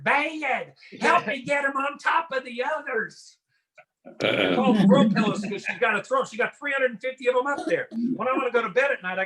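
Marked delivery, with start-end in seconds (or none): 0.0-3.4 high imitation of Southern wife; Southern accent. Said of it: "That's a two-syllable word".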